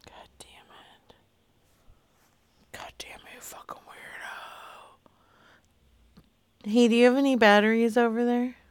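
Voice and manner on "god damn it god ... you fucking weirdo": very soft exasperated whisper; hushed, voiceless swearing; whispered cursing. Real, not acted. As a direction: curse under your breath. Cursing under breath at silent callee.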